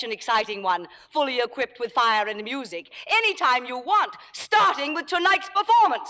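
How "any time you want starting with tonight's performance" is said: high-pitched, imperious dare; angry challenge. Acted. Star daring the playwright to replace her tonight, angry challenge. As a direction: throw down the challenge, pitch climbing.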